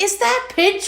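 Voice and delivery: high-pitched baffled question; sudden puzzled realisation. Retold or imitated. Replaying the logo sighting to a friend, high surprise. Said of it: "it was how I said it".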